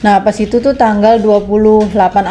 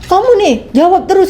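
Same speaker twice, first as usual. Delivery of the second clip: much higher, sharp mock yell; retold angry yell; 8 semitones higher than usual. Employer yelling at the maid for answering back, imitated much higher. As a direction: snap it like a furious boss.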